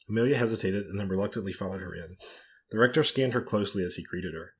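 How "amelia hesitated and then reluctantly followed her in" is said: ordinary delivery, nothing marked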